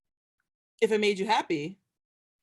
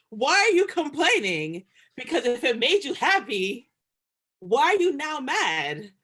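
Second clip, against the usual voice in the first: raised, louder exasperated scolding; mock scolding; 6 semitones higher, 8 dB louder than usual. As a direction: tell them off like they should know better. Scolding an imagined complainer.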